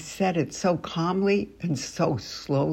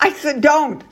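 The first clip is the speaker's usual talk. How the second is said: put-on high, sharp "don't" at a running child; sharp scold; 9 semitones higher, 3 dB louder than usual. Shown as a demonstration. Teacher scolding Isa in the hall, sharp and high. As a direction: snap the name, then the order. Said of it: "and you say".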